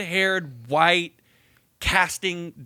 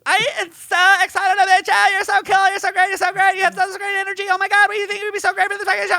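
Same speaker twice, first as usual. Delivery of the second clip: much higher, louder, gushing put-on voice; gushing casting director; 9 dB louder than usual. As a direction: ham up an overexcited talent scout on the phone. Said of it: "She's like".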